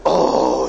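loud, long mock groan; imitated moan down the phone; desperate groan. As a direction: heave out a drawn-out "Oh". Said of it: "warum stöhnst du im Telefon".

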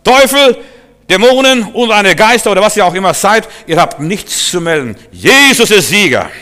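loud, high declaration against demons; triumphant, forceful command; commanding rebuke. Acted. Preacher acts out rebuking demons.

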